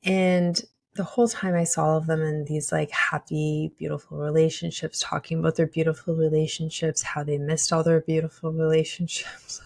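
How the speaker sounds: ordinary talk, nothing marked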